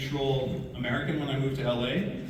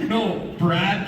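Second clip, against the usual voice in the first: ordinary talk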